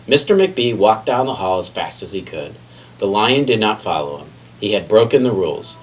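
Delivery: usual speaking voice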